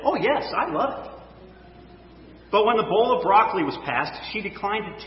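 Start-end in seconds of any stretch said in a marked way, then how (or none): 0.0-1.1 put-on bright, high little girl's reply; a little girl politely chirping yes; polite child. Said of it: "the child politely said".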